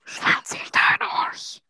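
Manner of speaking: slow unvoiced whisper. Real, not acted. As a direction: lean in and breathe it. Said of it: "das darf niemand hören".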